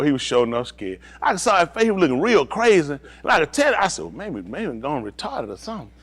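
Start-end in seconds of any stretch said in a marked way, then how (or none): 4.0-5.9 muttered aside. Retold or imitated. Muttered aside about scared man.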